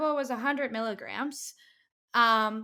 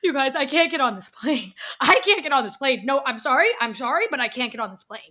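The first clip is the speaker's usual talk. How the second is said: loud, higher panicked pleading; mock bawling; 3 semitones higher, 8 dB louder than usual. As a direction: imitate a friend bawling and apologizing. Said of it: "She starts bawling immediately and is like".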